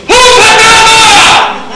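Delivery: high, drawn-out shout; exclamación asustada. Acted. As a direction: cry out loud in alarm, stretching the words. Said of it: "Note que está ahí en, entre signos de admiración". (grito fuerte de espanto)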